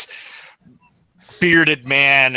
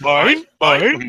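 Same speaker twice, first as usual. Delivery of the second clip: seagull impression; 5 semitones higher than usual. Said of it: "sound like a seagull from Finding Nemo".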